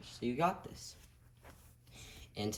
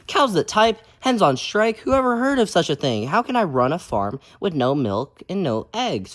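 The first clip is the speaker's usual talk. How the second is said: furious farmer; 3 semitones higher, 14 dB louder, slower than usual. Acted. Farmer fuming about cows and hens on strike, loud. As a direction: rant in disbelief at the cows. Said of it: "Farmer Brown was furious".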